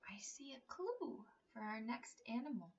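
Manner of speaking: soft secretive whisper; conspiratorial whisper to kids; whispered clue. Acted.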